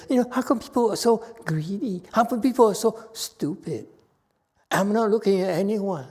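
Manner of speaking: very high mock-innocent complaint; hammed-up roundabout reproach in a high voice; indirect scolding. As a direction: wonder aloud, pointedly blaming nobody. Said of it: "They don't confront you".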